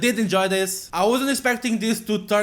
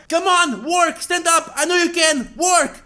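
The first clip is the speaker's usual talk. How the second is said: high, loud urging of failing magic; will the spell to work; desperate young girl; 10 semitones higher, 5 dB louder than usual. Acted. Yelanite girl pleading with her healing magic, desperate.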